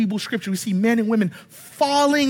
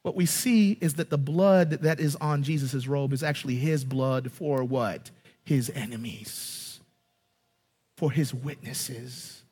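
hushed reveal; 9 semitones lower, 8 dB quieter than usual. Real, not acted. Preacher revealing whose blood it is, hushed. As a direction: drop right down to a grave hush.

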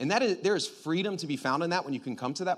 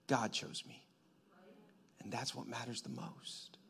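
hushed, much softer; soft whisper; 5 semitones lower, 13 dB quieter than usual. Acted. Preacher affirming God's choice, whispered. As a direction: let it fall to a whisper.

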